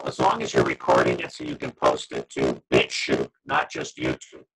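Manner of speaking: glitchy robotic call distortion; garbled digital robot voice. Real, not acted. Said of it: "They just turned you into a robot".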